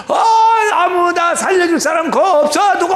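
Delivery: high loud crying shout for anyone; shouting for help. Retold or imitated. Deacon screaming for anyone to rescue him. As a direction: wail out for someone to save you. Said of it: "막 소리를 지르는 거야".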